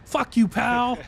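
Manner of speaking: imitating a comedy catchphrase; quoting a catchphrase. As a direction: fling the insult like a bit. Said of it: "that's from Dave Chappelle".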